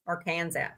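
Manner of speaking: low, soft "are Kansas" for Arkansas; mispronounced Arkansas. Shown as a demonstration. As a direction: quietly rhyme Arkansas with Kansas. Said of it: "I mispronounced my state's name".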